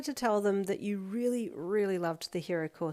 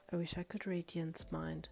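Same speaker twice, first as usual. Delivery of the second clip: whispered private thought; inner thought; 3 semitones lower, 11 dB quieter than usual. A narrator's reading. Girl wondering to herself, a breathy whisper. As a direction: whisper it to yourself, wishing. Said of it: "she thought".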